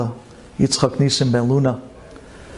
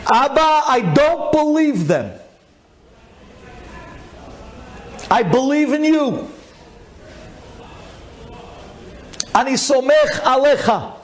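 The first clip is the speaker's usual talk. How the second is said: much higher, straining, near tears; desperate pleading prayer; 12 semitones higher than usual. Retold or imitated. Son praying to God for his father's leg, pleading tearfully. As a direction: cry out to God like a child begging his father.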